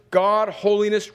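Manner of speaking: ordinary talk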